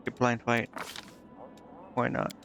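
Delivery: normal talking voice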